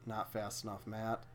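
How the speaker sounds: an unvoiced, breathy mutter; whispered. Real, not acted. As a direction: whisper it to yourself. Mutters during the game.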